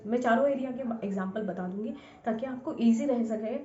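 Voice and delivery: ordinary talk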